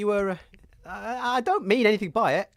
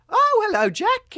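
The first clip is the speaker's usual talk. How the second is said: high, loud startled greeting; startled hello; 10 semitones higher, 6 dB louder than usual. Acted. Storyteller suddenly spotting Jack, high startled hello. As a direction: yelp the greeting in shock.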